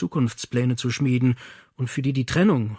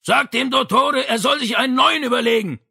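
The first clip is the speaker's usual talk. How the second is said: high, loud shout from below; father yells up from the kitchen below; 11 semitones higher, 5 dB louder than usual. Acted. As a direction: yell it up the stairwell.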